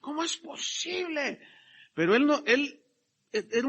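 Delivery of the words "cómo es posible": higher, quieter fake outrage "¿Cómo es posible?"; mocking fake piety; mock hypocrite. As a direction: mimic Judas pretending to be shocked. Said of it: "lo que dijo".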